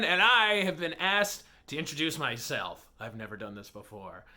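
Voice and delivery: usual speaking voice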